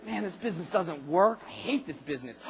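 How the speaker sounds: discouraged grumble. Retold or imitated.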